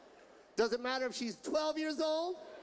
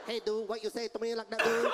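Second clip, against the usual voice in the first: low, rapid-fire mock Vietnamese accent; quick, confrontational Vietnamese-accented impression; fast Vietnamese accent; 5 semitones lower than usual. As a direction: imitate a Vietnamese challenger, words racing. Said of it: "they talk real fast".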